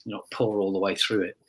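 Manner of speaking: ordinary delivery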